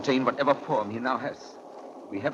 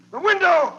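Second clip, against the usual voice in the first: high, loud shout of alarm; 11 dB louder than usual. Acted. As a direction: yell a warning to the others. Dracula escapes through the window.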